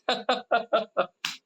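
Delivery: high, bouncing run of laughter; real laugh. Real, not acted. Preacher amused by everyday truth about crying, hearty laugh. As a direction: laugh out freely in a quick burst. Speaker laughs at own remark.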